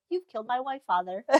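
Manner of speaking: understated, soft imitation of a stunned son; Draco, hushed. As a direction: say it small and quiet. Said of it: "Draco just goes".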